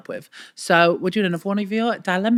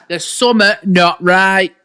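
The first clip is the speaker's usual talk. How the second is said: mock ominous; 3 semitones higher, 7 dB louder, slower than usual. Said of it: "slow mock-ominous remark".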